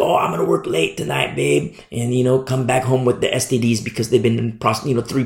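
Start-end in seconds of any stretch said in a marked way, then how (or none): 0.0-1.8 whispered, low voice of a cheating husband; mock husband. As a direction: imitate the husband sneaking off, low and hushed.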